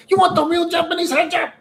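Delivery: high mock grandma with broken English. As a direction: squeak it like a pushy grandma. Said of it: "she's like".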